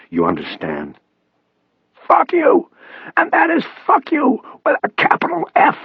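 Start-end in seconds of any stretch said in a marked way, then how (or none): 2.1-5.8 high, forceful strained rasp; defiant rasp. A narrator's reading. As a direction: throw it back at him in a rasp. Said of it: "doing his best to stand up to him".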